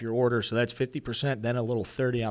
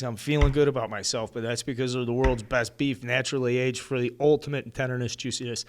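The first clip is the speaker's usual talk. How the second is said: ordinary delivery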